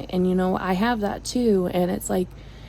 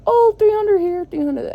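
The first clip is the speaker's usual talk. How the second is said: much higher, breezy dismissive mimicry; mock their careless tone; mocking others; 11 semitones higher, 4 dB louder than usual. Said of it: "And they're like".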